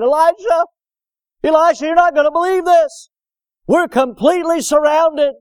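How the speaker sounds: high-pitched, urgent acted cry; alarmed servant. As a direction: call out the bad news in acted panic. Servant runs in to report army.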